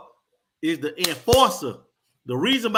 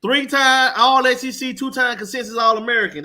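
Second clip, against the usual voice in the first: hype read; 7 semitones higher, 6 dB louder than usual. Real, not acted. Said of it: "repeating the accolades louder".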